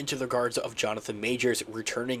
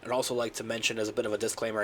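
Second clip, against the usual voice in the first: ordinary delivery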